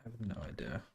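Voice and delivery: ordinary delivery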